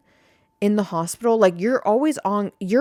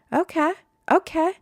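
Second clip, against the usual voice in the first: flustered surprise; 8 semitones higher than usual. Retold or imitated. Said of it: "And you're like".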